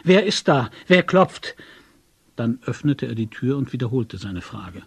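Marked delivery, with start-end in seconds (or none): 0.0-1.5 loud, high challenge; strained, unfamiliar shout; strange, altered voice. A narrator's reading. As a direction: call out of the window into the night. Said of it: "mit einer völlig fremden Stimme".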